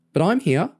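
high, eager offer; excited, pitched well up. A narrator's reading. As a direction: pipe up eagerly to be included. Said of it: "sounding excited".

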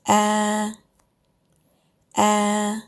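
A said level, mid pitch, held steady; a flat A with no rise or fall. Shown as a demonstration. Teacher demos a flat mid tone. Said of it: "The first is a flat tone with no mark, not low, not high".

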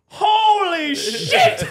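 very high, drawn-out yell of amazement; shocked shout. Retold or imitated. Irish gamers watching the plane, shocked yell. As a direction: let it out slow and stunned. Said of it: "It's like".

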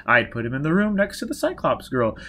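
keen, mildly wheedling persuasion; persuasive. Acted. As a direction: sell the idea to a reluctant client. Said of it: "Baker said persuasively".

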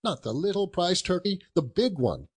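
chuckling, eager, climbing in pitch; giddy delight. Acted. Scrooge asks the boy about the prize turkey, giddy delight. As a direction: bubble over with little laughs.

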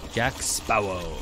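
low, slow gruff pirate. Shown as a demonstration. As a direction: growl it like a pirate. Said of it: "in a pirate voice".

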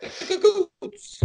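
squeaky, very high and slow cartoon Italian; mock Italian examiner. Mocking the Italy citizenship exam, squeaky and slow. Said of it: "a citizenship requirement exam for Italy".

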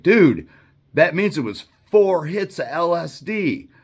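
alarmed friend shouting that he took four hits; alarmed buddy shout. Retold or imitated. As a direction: yell it at your friend in disbelief. Buddy alarmed, voiced in story.

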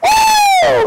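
high, long "woo"; loud falsetto-high cheer; whoop. Shown as a demonstration. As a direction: let out a soaring revival whoop. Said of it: "When I say, "Woo!".

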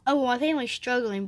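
normal talking voice

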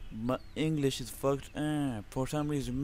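usual speaking voice

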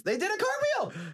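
high-pitched, excited exclamation; excited yell. Real, not acted. Streamer yelling reaction to an unseen cartwheel, excited yell. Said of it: "I'm just, like, yelling into nothing".

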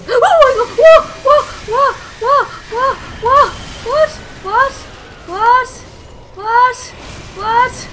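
high, loud yelps of astonishment; shocked disbelief. Real, not acted. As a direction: blurt it out loudly in stunned disbelief. Shock at game sight, shouting.

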